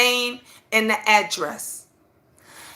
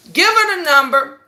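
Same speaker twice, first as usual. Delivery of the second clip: ordinary delivery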